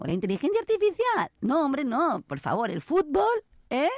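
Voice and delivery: high, theatrical disbelief; mock incredulity. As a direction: scoff at the idea. Mocking what motivates humans.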